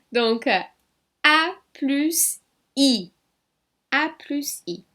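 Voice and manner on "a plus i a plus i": "A plus I" said slowly and a bit louder; slow spelling. Shown as a demonstration. Teacher spells "A plus I" slowly for students. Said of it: "en français".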